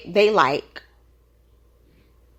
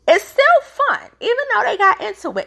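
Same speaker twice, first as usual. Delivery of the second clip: excited; 12 semitones higher than usual. Real, not acted. Excited aside on the show.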